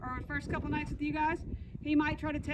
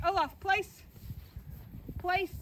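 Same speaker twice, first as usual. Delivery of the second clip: dog command; 5 semitones higher, slower than usual. Shown as a demonstration. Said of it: "It's gonna look like this".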